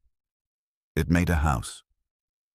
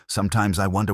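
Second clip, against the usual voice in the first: ordinary delivery, nothing marked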